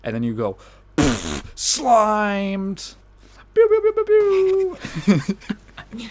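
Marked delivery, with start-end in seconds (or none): 1.5-6.1 high, loud mock laser zaps; slime gun sounds. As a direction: zap him with a slime gun. Imagined slime gun sound effects.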